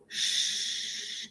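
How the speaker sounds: "sh" drawn out with no voice; a voiceless sh held for a demo; unvoiced sh. Shown as a demonstration. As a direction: breathe "sh" with the throat still. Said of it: "no voice sound".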